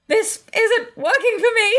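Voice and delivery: squeaky reluctant complaint. Retold or imitated. Re-enacting the dreaded hotel complaint, high and strained. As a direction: squeak it out, breaking into a laugh.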